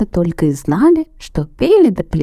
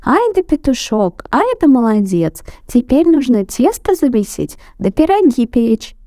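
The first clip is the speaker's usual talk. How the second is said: high squeaky praise; mice praising; 8 semitones higher than usual. A narrator's reading. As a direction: squeak with delight. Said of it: "mice praising".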